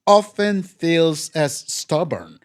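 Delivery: ordinary talk, nothing marked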